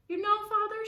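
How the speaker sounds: high, lightly voiced mother; mother's voice. A narrator's reading. Missus Duncan turning to her husband, high mother's voice. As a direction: speak up as the mother.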